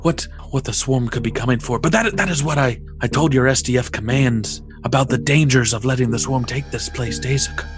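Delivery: lightly voiced elderly scholar; weary old priest, sighing; old sage voice. NPC Zelanan speaking.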